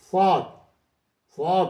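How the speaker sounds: صاد said heavy with a full, rounded mouth; full-mouthed saad. Shown as a demonstration. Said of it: "پورے منہ سے بھر کے". (پورے منہ سے بھاری صاد)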